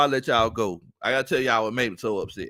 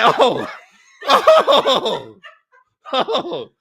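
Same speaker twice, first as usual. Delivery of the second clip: laughing; 13 semitones higher, 9 dB louder, much slower than usual. Retold or imitated. Finds surprise pizza box.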